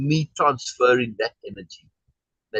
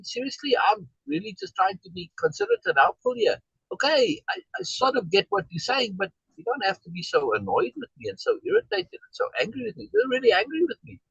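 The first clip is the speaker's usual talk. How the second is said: annoyed retort; 10 semitones higher than usual. Retold or imitated. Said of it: "And then I got annoyed at her being annoyed at me".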